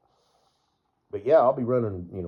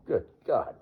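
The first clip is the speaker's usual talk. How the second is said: ordinary talk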